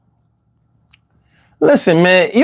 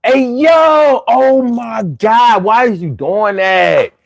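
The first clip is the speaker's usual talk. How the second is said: Philly drawl, words dragged long; high, slow, stretched-out Philly talk; drawn-out Philly accent; 5 semitones higher, much slower than usual. Shown as a demonstration. Showing viewers how to talk Philly again, dragged-out Philly accent. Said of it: "drag my words out a lot".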